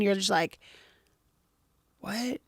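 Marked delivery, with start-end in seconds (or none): 2.0-2.4 softly puzzled, acted-out reaction; a small, bewildered question; baffled soft what. Unbothered person answers an outraged companion, softly baffled.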